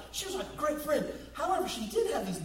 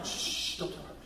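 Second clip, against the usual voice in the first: hushed whisper to the crowd; conspiratorial softer aside; 4 dB quieter than usual. Real, not acted. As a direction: let them in on a secret, softly. Secret from mom to crowd.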